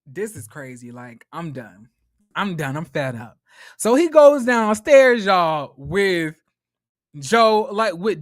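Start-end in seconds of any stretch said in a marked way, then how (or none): 0.1-3.4 weary resignation. Retold or imitated. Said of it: "he's saying".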